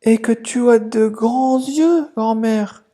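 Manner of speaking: put-on a polite child at the door; little girl. Girl questions grandma. (la fillette à la porte, voix aiguë)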